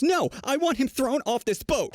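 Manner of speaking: high, slow Karen screech without real volume; mock scream. Angry mother demanding action from staff, mock screaming. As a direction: imitate an outraged woman, drawn out and high. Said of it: "The Karen screams".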